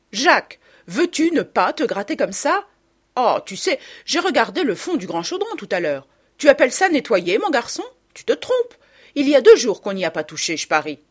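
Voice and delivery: loud, high, nagging reproach; exasperated mother's telling-off; mother scolding. A narrator's reading. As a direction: take a child to task sharply. Mother scolds her son.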